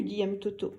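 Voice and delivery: ordinary delivery, nothing marked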